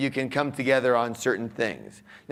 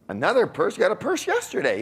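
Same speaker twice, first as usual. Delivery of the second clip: re-enacted indignant disbelief, rising high; mock a husband scolding over spending; exasperated husband; 8 semitones higher, faster than usual. Husband challenges wife about another purse, mock exasperation.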